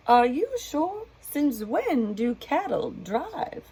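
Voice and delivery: high, slow unsure deputy voice; doubtful deputy. Acted. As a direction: ask it in disbelief, dragging it out. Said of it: "His deputy said".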